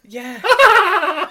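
a loud cackling laugh over the word; loud mock villain laugh; laughing yeah. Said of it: "She's got the laugh down".

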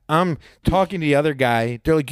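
ordinary talk, nothing marked

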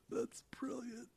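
breathy whispered aside. Real, not acted. Reader whispering delight at the script's line. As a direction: whisper it to yourself, amused. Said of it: "whispered aside".

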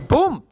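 loud, high "pum"; sudden bang effect. Retold or imitated. Teacher shouts the sudden grab, loud and high. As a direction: burst out with the bang.

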